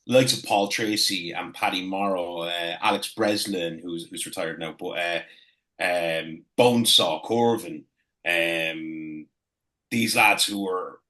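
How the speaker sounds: ordinary delivery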